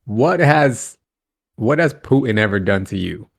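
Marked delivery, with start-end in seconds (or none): none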